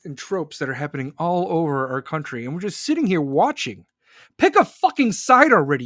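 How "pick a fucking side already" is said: higher, much louder furious demand; angry shout. Real, not acted. Ranting vlogger yells at people who won't take sides, furious. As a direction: yell it at the cowards.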